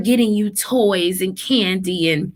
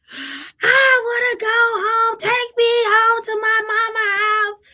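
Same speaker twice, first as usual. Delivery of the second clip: crying kid imitation; 12 semitones higher than usual. Mom imitating her crying son begging to go home, whiny. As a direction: whine it like a sobbing kid. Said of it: "he cried, screamed, had a meltdown".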